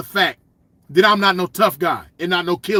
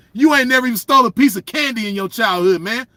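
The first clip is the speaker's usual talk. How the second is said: ordinary talk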